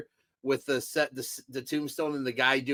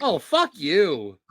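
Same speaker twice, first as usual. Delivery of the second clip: mock outrage; 4 semitones higher, 10 dB louder, much slower than usual. Mock-offended retort to teasing.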